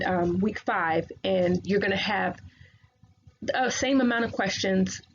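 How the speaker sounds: normal talking voice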